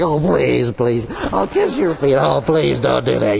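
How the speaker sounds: lightly voiced groveller; grovelling plea. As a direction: mutter pleas from the floor. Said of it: "getting on the floor and grumbling".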